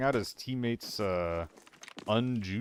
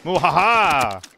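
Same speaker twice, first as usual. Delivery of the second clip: slow, loud evil cackle; mad scientist laugh; 4 semitones higher, 11 dB louder, slower than usual. Pretend. Evil laugh after ambush.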